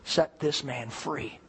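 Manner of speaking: hushed, low retold prayer; quiet prayer. Preacher retells praying with a hand laid on the man, quiet prayer. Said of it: "I prayed and I said".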